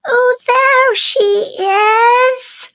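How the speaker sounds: high, eager little bird spotting its mother; a small chick crying out in hope; excited baby bird. Acted. Baby bird spots the boat.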